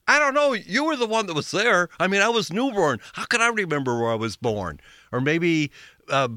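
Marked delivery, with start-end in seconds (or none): 0.1-4.8 high-pitched mock teenage backtalk; smart-aleck teen. Teen talking back to dad's barn question, high cheeky retort. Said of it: "I might have answered differently, like".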